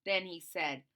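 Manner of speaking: ordinary delivery, nothing marked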